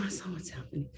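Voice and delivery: whispered correction. Retold or imitated. Teacher correcting a student about corona, whispered. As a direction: hush it, correcting them. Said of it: "I'm like".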